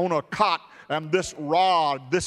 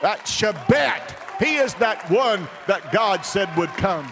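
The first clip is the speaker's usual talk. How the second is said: preacher building to a climax; full-force preaching; 4 semitones higher than usual. Acted. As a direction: preach it home with rising fire.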